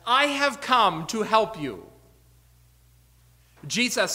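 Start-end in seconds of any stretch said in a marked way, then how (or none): 0.1-1.9 loud, higher, slow rescuer's declaration; lightly acted heroic paramedic; EMT announcing. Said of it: "the E M T comes to rescue you and says".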